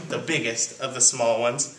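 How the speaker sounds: ordinary delivery, nothing marked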